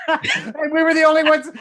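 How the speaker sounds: talking through high laughter; laughing. Real, not acted. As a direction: laugh your way through the words. Laughing at remembered film joke.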